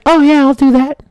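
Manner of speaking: put-on high kid fibbing to firemen; little kid voice. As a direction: play dumb like a small child. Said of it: "And I said".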